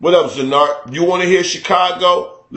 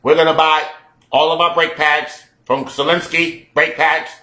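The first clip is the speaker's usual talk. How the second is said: Dan Aykroyd. Acted.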